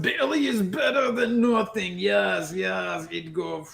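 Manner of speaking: high, loud alien salesman, settling down; Volto. Acted. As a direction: insist eagerly in a put-on character voice. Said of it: "goes with his voices".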